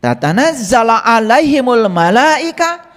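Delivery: Quran recitation. Acted. Preacher reciting a verse to the congregation, slow chant. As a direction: intone the Arabic slowly, like tilawah.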